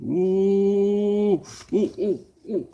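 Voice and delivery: long high ooh, then short ones. Pretend. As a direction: hoot a high ooh, then quick little oohs. Mock reaction to being angered.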